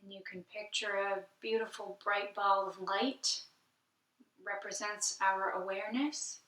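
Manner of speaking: soft, unhurried meditation voice with long pauses; guided meditation. Acted.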